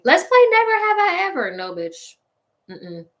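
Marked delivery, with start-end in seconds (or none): 0.0-1.6 high, loud imitation of an eager game starter; mock party excitement. Mocking a tipsy friend starting a drinking game, mock excitement. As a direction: squeal it out, mocking the instigator. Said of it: "they wanna start the game".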